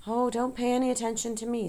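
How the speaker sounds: high, subdued, mildly sad; understated melancholy. A narrator's reading. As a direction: wave away concern with a touch of sadness. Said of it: "Charlotte's voice sounded sad".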